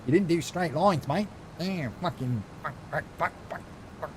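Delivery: hammed-up Aussie grumble, trailing into muttered swearing; exaggerated Australian accent. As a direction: play the whiny Australian moderator, over the top. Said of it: "I've got an Australian moderator".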